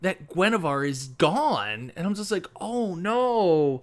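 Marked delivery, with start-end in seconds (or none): 2.6-3.8 higher, drawn "oh no"; mock-tearful lament for a lost friend; retold dismay. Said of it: "my eyes started tearing up".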